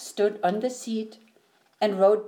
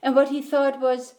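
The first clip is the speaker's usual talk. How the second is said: ordinary delivery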